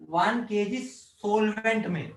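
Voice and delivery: slow, measured one KG solvent; emphatic repeat. Real, not acted. Teacher repeating the key condition for the class, slow and emphatic. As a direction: spell out one KG solvent slowly, word by word. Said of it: "दोबारा बोल रहा हूं".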